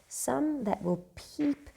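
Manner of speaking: high, slow peeping voice; peeping demo. Shown as a demonstration. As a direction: squint and say it up high. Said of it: "you peep through your eyes like that".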